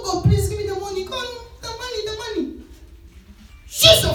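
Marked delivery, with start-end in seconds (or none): 0.0-2.6 high, soft pleading voice; mock whining plea to God; whiny begging prayer. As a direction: beg pitifully for the money.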